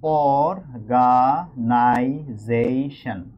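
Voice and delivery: অর্গানাইজেশন drawn out in five long, separate syllables. Shown as a demonstration. Teacher demonstrating pronunciation to the class, অর্গানাইজেশন slowly split into five syllables. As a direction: pronounce অর্গানাইজেশন very slowly, one piece at a time. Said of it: "pronunciation করতে যাই".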